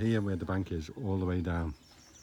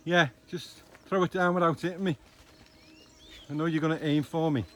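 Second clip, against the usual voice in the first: loud, higher, teasing instructions to partner; calling out; 9 semitones higher, 7 dB louder than usual. Real, not acted. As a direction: call up to someone about to throw. Calls to partner throwing stick.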